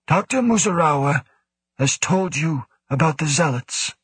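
lightly voiced cool commanding official; stern director. A narrator's reading. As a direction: speak as the stern station director. Woe speaks.

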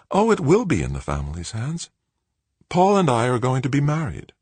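spring the news with relish, pitched high; slightly wicked. A narrator's reading. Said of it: "Joanna's smile turned slightly wicked".

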